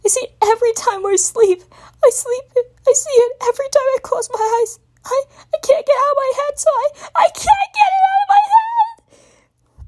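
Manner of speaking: high crying, rising to a shout; escalating wail. Acted. Spike wailing that he cannot stop the memory. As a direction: build from wailing to a sobbing shout. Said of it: "wailed out the dragon".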